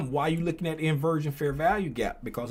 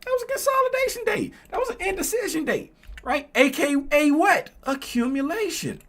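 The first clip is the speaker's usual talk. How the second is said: high, loud, racing through the point; fired-up teaching; 12 semitones higher, 6 dB louder than usual. Real, not acted. Teacher firing up the students about accumulation, high and loud. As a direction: preach it to the class, building hard.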